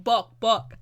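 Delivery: loud barked mock ad-lib. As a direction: punch it out loud. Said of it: "I don't know how he says it".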